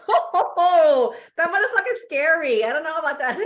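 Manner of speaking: high laughing disbelief. Retold or imitated. Retelling first seeing the box, laughing loudly. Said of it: "I was like".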